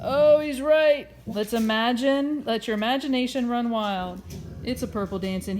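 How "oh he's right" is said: loud, slow delighted exclamation. Real, not acted. Mother reading to her child, delighted. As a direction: cheer out the discovery.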